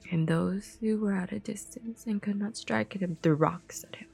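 usual speaking voice

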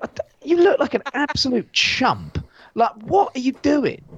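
high, scornful jeer; mocking disbelief. Mocking a wrestler in a beanie, high scornful jeer. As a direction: tease him like a chump. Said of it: "And you're like".

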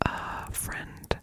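ordinary talk

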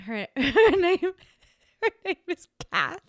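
high, giggly, halting delivery; laughing. Real, not acted. Introducing a friend to listeners, laughing. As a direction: struggle to get it out through giggles.

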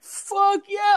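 high excited yell; triumphant shout. Retold or imitated. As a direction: cheer it out loud. Celebrating Dolphins win.

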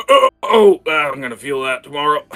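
loud groans, then quick complaint; groaning old man. Acted. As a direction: groan as your old joints pop. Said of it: "and him groaning".

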